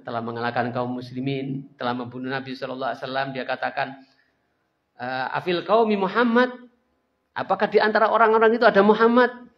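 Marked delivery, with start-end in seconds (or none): 4.9-9.5 loud boastful taunting call; proud shout. Acted. Abu Sufyan taunting the Muslims, loud proud shout. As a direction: shout it out across the battlefield, gloating. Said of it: "Kata Abu Sufyan berteriak dengan bangganya".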